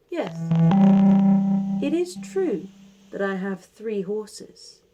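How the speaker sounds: put-on calm, measured old man voice; wise old sage. Old man repeats his saying.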